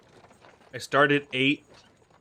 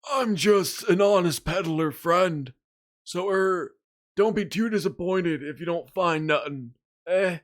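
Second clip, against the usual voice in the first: put-on higher, slow sly old peddler; old man voice; 4 semitones higher than usual. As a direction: play a wheedling old trader. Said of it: "old man voice reused for peddler".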